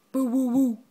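dog bark imitation. Shown as a demonstration. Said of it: "What does the doggy say?".

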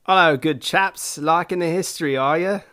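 put-on high, loud, poorly done British accent; bad British accent. Podcast host greets listeners as good chaps, bad British accent. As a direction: put on a silly, badly faked British voice. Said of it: "this poorly represented British accent".

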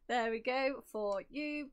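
ordinary talk

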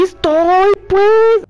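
exasperated parent raising the voice; arguing with her son. Real, not acted. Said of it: "Estoy peleando con mi hijo".

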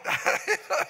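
high breathy chuckle; laugh. Real, not acted. Preacher chuckling at his own joke to the church, a high breathy chuckle.

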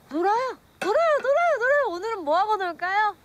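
high, loud tipsy pleading; sing-song drunk begging to play; drunk and giddy. Acted.

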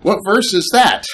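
high, punchy rhetorical jab; pointed mock question. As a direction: ask "What verse is that?" as a playful challenge. Mock-challenging question, laughing after.

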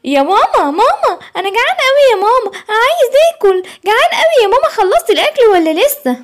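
put-on very high, whiny kid voice; a little cub begging his mum for food; whining hungry child. Simba whining to mama that he is hungry, very high.

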